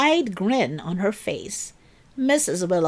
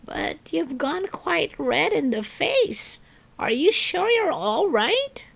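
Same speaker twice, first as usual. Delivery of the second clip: lightly voiced kind Missus Willow, higher; a hint of a concerned older lady asking; gentle older lady; 8 semitones higher than usual. A narrator's reading.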